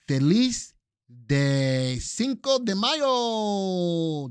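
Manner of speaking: loud, drawn-out mock Spanish accent. Host wishes viewers happy Cinco de Mayo, hammy Spanish accent. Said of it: "That was my Spanish accent".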